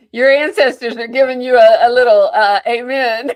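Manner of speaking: loud laughing, words through laughter; laughing teasingly. Real, not acted. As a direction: tease him while bursting into laughter. Host laughs at guest's emotion.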